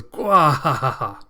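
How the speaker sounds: loud, slow booming villain cackle; evil villain laugh. Acted. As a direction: laugh like a gloating monster. Makaku laughs at the child.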